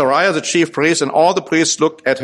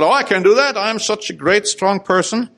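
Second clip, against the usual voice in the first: boastful king; 7 semitones higher than usual. Retold or imitated. Preacher voicing proud King Uzziah's thoughts, boastful and raised. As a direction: puff up like a proud king who thinks he can do anything. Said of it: "But he thought".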